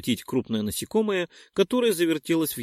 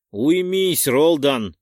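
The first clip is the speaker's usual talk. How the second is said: commanding shout; 3 semitones higher, 7 dB louder, much slower than usual. Acted. The narrator stops Roldon from fighting, commanding shout. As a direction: rein him in hard. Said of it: "осадил я спутника".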